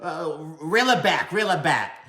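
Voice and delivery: high, put-on sassy voice; mock diva warning; sassy "girl" voice. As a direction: tell her to tone it down, campy. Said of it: "I was like".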